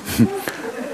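high breathless giggle; squeaky laugh. Real, not acted. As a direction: burst into a short giggle. (kurzes hohes Kichern)

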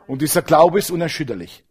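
ordinary talk, nothing marked

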